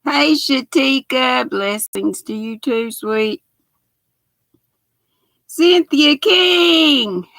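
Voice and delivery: normal talking voice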